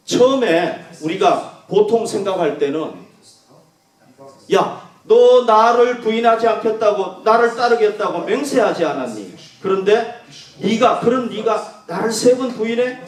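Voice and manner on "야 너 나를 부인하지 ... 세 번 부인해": a hurt teacher demanding why Peter denied him; imagined rebuke. Retold or imitated. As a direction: reproach him like a betrayed friend. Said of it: "이렇게 책망할 수 있잖아요".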